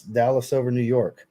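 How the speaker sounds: normal talking voice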